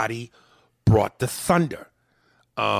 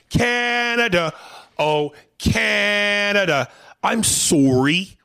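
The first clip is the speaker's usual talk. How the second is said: high, loud, slow mock Canadian sing-song; mock Canadian accent; 10 semitones higher, 6 dB louder, much slower than usual. Host teasing a Canadian listener in a mock Canadian accent. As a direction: ham up a Canadian accent, stretching every vowel. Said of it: "That's how they say sorry".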